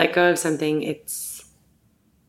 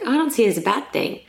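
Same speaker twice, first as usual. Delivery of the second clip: quick retold reply; 6 semitones higher, much faster than usual. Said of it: "I was like".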